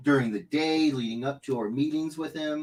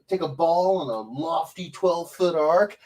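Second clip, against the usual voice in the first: ordinary talk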